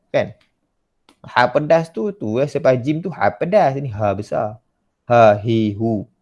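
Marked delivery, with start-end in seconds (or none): none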